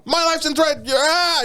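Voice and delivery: mock alarm.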